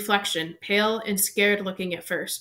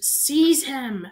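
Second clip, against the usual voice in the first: higher, louder scream; 5 semitones higher, 4 dB louder than usual. A narrator's reading. Voldemort screaming at Quirrell to grab Harry. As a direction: scream the order. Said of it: "Voldemort screamed".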